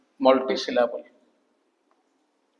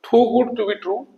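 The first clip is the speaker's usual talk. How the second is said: slow, careful reading of the idiom; slow dictation; 3 semitones higher, much slower than usual. Shown as a demonstration. Teacher dictating an idiom to students, slow. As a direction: read it out word by word. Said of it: "ଇଡିଓମେଟିକ expression".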